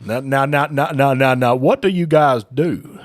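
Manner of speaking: loud, blustering Southern cartoon rooster; big Southern bluster, stretching the final question; Foghorn Leghorn. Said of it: "Oh, Foghorn Leghorn".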